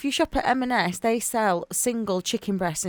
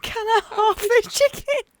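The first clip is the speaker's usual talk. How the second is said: high mock voice; 12 semitones higher than usual. Mimicking Meg's shop request.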